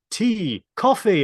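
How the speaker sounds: two words called out as a meme impersonation; Picard. Acted. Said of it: "Picard's like".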